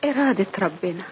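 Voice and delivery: timid and quiet. Acted. Girl answers timidly.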